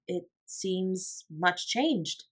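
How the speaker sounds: soft, slow, shaken reply; shaken, quiet. A narrator's reading. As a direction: say it slowly, pale with dread. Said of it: "very pale".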